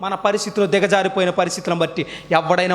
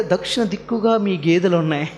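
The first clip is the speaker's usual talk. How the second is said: mock fortune teller; 4 semitones lower than usual. Fortune teller's pronouncement mocked.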